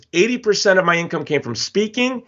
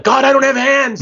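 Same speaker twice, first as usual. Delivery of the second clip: high, raised-voice mock protest to God; hammed-up exasperation, pitched way up; exasperated protest; 10 semitones higher, 4 dB louder than usual. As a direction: cry it out as an indignant punchline. Nick's protesting punchline to God.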